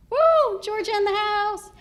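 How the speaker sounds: high, loud whoop; rowdy cheer. Acted. Woman cheering for her home state, rowdy whoop. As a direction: whoop it up for the hometown crowd.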